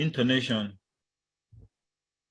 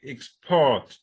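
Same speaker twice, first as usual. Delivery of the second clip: export with PORT punched louder; stress on second syllable; 3 semitones higher, 5 dB louder than usual. Shown as a demonstration. Teacher showing stress in a word pair, export stressed on PORT. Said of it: "stress that you could have".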